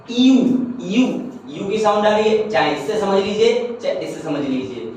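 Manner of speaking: usual speaking voice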